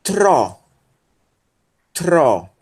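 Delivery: "tro" as one isolated, clearly articulated syllable; deliberate, separated "tro"; syllable demo. Shown as a demonstration. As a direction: model "tro" for learners, pausing between repeats. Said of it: "vai ser pronunciado como".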